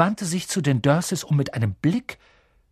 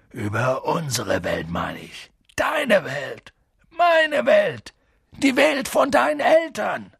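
Hagrids Stimme; 6 semitones higher, slower than usual. A narrator's reading. Hagrid erklärt erregt.

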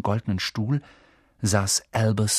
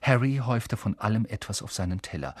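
ordinary delivery

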